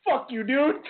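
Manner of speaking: high, laughing mock outrage; mock indignant friend. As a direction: play a friend's mock offence. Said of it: "he's like".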